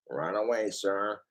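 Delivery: low, soft obedient assistant; Smithers. Acted. Smithers answers his boss, low obedient voice. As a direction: drop low and answer dutifully. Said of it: "My Simpsons voices".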